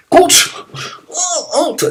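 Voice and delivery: "coach" hurlé très haut et très fort; crier coach. Retold or imitated. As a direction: appelle le coach à pleins poumons. Appelle le coach sur le terrain. (crie "coach" comme un joueur à terre)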